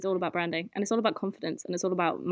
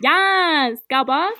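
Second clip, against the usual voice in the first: excited cheer; 7 semitones higher, 8 dB louder, much slower than usual. Real, not acted. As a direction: hype her up loudly. Cheering friend on.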